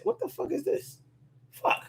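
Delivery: sudden high, hushed surprise; startled by something mid-video. Real, not acted. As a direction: react to something odd.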